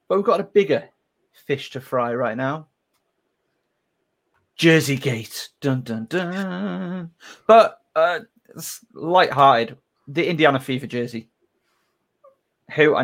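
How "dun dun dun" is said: dramatic sting. Acted. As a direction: hum a suspense sting. Dramatic Jersey Gate sting.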